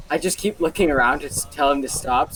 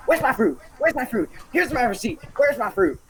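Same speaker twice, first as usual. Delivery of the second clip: angry demanding; 9 semitones higher than usual. Retold or imitated. Drunk lady demanding her fruit.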